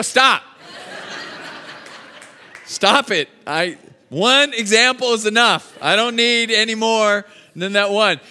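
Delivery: dad cutting off son's stories, half-joking; mock protest. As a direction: wave off the teasing loudly. Begging son to stop teasing.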